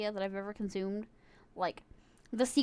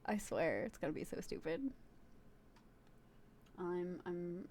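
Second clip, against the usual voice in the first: ordinary delivery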